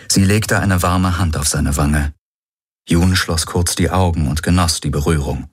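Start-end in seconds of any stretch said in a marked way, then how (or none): none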